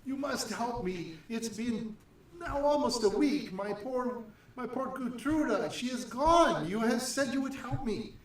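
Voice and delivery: high, pleading and tearful; tearful mother. Acted. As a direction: beg for help through tears. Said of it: "she's crying".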